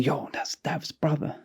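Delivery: soft voiceless whisper; whispered aside. Retold or imitated. Ali whispering to his friend who the stranger is. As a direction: tip your mate off under your breath. Friend identifies guy quietly.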